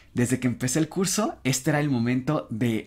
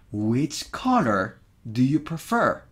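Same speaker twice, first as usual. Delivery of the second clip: Which color do you prefer? slow and even; slow model English; slower than usual. Shown as a demonstration. Teacher slowly modelling the which question. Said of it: "de inmediato yo empiezo a sospechar que es un which".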